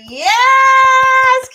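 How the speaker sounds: loud, high cheer; big celebratory shout. Real, not acted. Cheering the launch.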